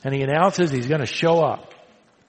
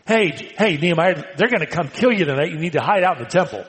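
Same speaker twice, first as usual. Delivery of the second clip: hurried warning; much faster than usual. Acted. Enemies warn Nehemiah.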